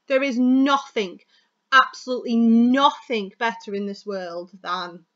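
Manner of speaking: slow, loud stress on each "nothing"; pause, then hit "absolutely nothing" hard and slow; emphasis on nothing. Shown as a demonstration. Coach demonstrating keyword emphasis, "nothing" stressed loud and drawn out. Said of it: "I'm emphasizing nothing and absolutely nothing".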